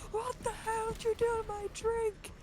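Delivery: high-pitched indignant demand; mock outrage. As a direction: cry out in hammed-up alarm. Acted outraged reply to prank.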